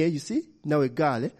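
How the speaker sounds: usual speaking voice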